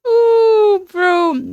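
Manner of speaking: high squealing ooh; excited squeal. Real, not acted. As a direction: squeal it out, delighted. Excited reaction to a TikTok.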